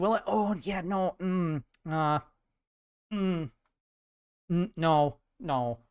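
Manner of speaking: acted embarrassed evasion; reluctant denial. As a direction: stall with hesitant little noises before denying it. Acts reluctance to admit Florida origin.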